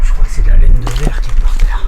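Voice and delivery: hushed unvoiced whisper. Real, not acted. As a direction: whisper it to yourself. Whispered guess, then repeated aloud.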